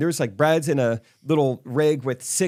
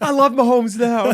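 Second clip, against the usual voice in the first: much higher and louder, gushing; mock convert cheer; 11 semitones higher, 6 dB louder than usual. Swick gushing that he's now a fan, squealing. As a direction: squeal it like a giddy new fan. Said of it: "He's like".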